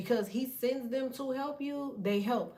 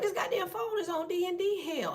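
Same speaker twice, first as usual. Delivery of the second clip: mock outrage; 9 semitones higher than usual. Frantic you at phone.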